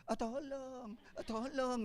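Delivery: soft, lazy calls of tolong; a half-hearted, unbothered call for help; what a robbed woman would never say; limp mock plea. Shown as a demonstration. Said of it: "ada loh".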